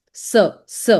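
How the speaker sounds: "S" said as an isolated "suh"; CE sound. Shown as a demonstration. Teacher isolates the CE sound, short suh. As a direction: say "S" as the sound suh, not the letter name. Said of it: "it gives a sound of S".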